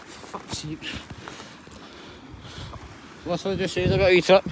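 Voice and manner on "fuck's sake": muttered curse. Real, not acted. Curses after slipping up.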